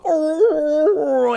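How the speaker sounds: lightly mocked death moan; mock dying wail. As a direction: moan "Oh." like a creature on its side. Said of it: "just a distressed wail".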